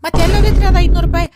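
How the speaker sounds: loud, fast scolding yell at the husband; angry shout. Acted. Wife yelling at husband about the missing cash, angry shout. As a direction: shout the accusation.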